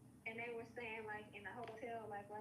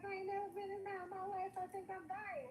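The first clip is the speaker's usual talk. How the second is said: higher, fast mock panic; 8 semitones higher, 3 dB louder than usual. Cousin panicking on edibles, voiced high and fast. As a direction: imitate a cousin sure she's dying.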